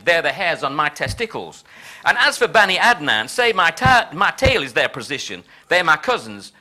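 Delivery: emphatic rhymed boasting in a put-on Texan accent; George Bush, mock Texan. President addressing his envoy before Gulf mission, mock Texan. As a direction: declaim it like a swaggering Texan. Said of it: "my appalling Texan accent".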